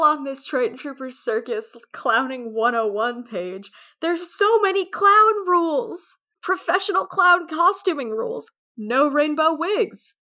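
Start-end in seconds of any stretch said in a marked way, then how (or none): 4.0-10.1 excited. Real, not acted. Reading clown rules excitedly.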